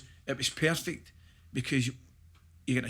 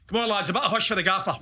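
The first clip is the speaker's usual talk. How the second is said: quick, higher-pitched mock plea for hush; Sandy Stewart; 6 semitones higher, 3 dB louder, faster than usual. Player chips in for the camera after the manager's order, quick mock hushing. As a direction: hurriedly shush the lads, played for laughs. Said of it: "wee Sandy Stewart says".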